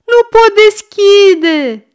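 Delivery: very high, loud, drawn-out bawl; desperate yell. A narrator's reading. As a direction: bawl like a trapped, desperate child. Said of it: "zbiară Goe desperat". (zbierăt disperat)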